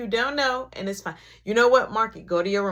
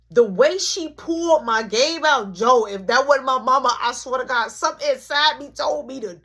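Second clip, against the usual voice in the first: ordinary delivery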